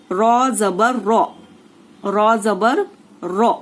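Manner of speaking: रॉ said with a thick, heavy full R sound; slow and thick, रॉ with a full, heavy ra. Shown as a demonstration. Tajweed teacher demonstrating a heavy रॉ, slowly. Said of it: "इसी को पुर आवाज कहते हैं".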